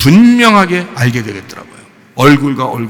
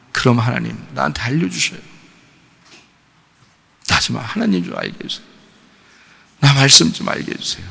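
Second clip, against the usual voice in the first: softer, tearful plea to God; 2 semitones lower, 6 dB quieter than usual. Retold or imitated. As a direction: beg God quietly through tears. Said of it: "그러면서 하는 말이". (눈물 섞인 조용한 간구)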